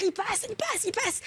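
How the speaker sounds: ordinary talk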